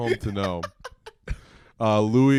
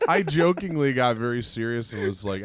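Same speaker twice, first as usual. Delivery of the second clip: usual speaking voice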